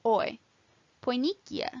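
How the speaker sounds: put-on "oi" as in boy, then "poinikia" starting with it; OE diphthong. Shown as a demonstration. Teacher modelling a diphthong, "poinikia" with one oy sound. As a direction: say "poinikia" with an oy glide. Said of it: "are pronounced as one sound".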